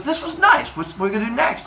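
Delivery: very high put-on girl voice; Mincinin. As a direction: chirp it as the pleased girlfriend. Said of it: "And she goes".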